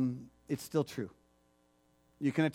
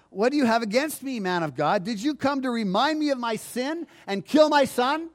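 high, louder, accusing cry; grieving mother's accusation; 7 semitones higher, 4 dB louder than usual. Acted. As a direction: hurl it at him like a broken mother. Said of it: "She said to Elijah".

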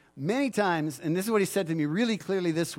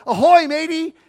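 playful pirate greeting; 9 semitones higher, 10 dB louder than usual. Retold or imitated. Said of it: "and I go".

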